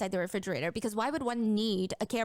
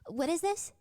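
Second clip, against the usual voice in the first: a light hint of a higher teen girl's voice; teen girl's question; 8 semitones higher than usual. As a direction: voice her lightly, puzzled. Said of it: "she asks the officers".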